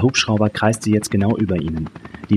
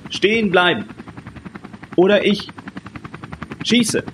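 high, strained warning shout; shouted threat; 12 semitones higher than usual. A narrator's reading. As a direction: call it over noise, breaking it into separate bursts. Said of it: "Was hatte der Mann da geschrien?". (hoch gerufene Drohung, in Stößen)

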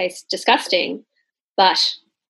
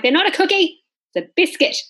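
indignant correction; 9 semitones higher than usual. Retold or imitated. Indignant retort to Americans.

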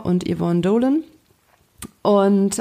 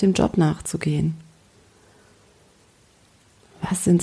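guided meditation. Acted. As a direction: gently lead a daydream. Guides a calm visualization. (ruhige Fantasiereise-Stimme)

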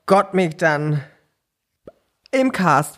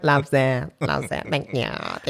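vocal fry endings; 3 semitones lower, 5 dB quieter than usual. Retold or imitated. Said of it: "er hat auch ein bisschen Vocal Fry".

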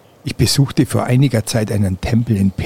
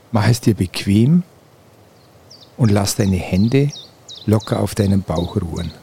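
guided meditation. Acted. Leads a guided relaxation.

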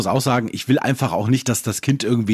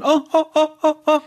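high excited oh oh oh; excited toddler oh; 3 dB louder, faster than usual. Retold or imitated. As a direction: imitate a pointing toddler. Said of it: "mit dem Finger drauf gezeigt".

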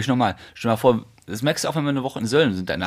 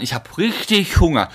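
ordinary talk

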